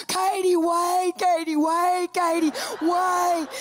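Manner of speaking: high, drawn-out panicked calling; hammed-up pleading wail from behind; freaking-out nurse. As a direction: imitate a frantic nurse's drawl. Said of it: "she's freaking out".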